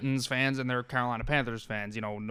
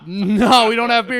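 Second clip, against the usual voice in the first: mock emphatic no; 8 semitones higher, 11 dB louder than usual. Retold reply to beer request.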